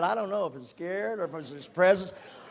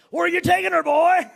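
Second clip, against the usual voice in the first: louder, higher gruff shout; repeated demand; 11 semitones higher, 8 dB louder than usual. Retold or imitated. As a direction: bark it out even harder.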